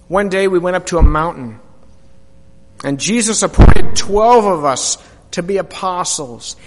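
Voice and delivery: ordinary talk, nothing marked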